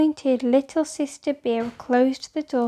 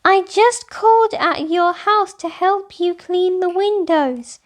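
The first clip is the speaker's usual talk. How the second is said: higher, louder reproach; little girl's accusing complaint; accusingly; 7 semitones higher, 6 dB louder than usual. A narrator's reading. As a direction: accuse her friend, a bit hurt. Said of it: "somewhat accusingly".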